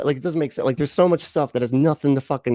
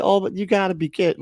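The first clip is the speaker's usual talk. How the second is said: ordinary delivery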